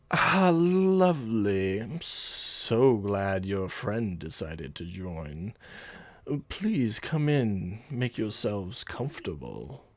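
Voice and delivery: smooth, very slow captain receiving guests; suave captain. Acted. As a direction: draw every phrase out like a suave host. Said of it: "the captain's suave voice".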